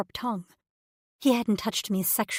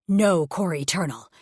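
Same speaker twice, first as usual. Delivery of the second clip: low, firm snap with an edge of anger; snapping; 3 semitones lower, 4 dB louder than usual. Acted. Alien mate snaps at his pregnant partner, low and firm. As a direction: cut her off with a deep rumble. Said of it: "he snapped".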